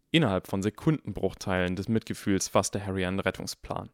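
ordinary delivery, nothing marked

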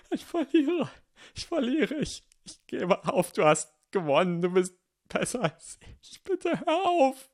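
high, tearful begging surrender; sobbing plea. Acted. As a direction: beg through tears to stop. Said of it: "Tränen zurückzuhalten längst aufgegeben". (weinend flehend)

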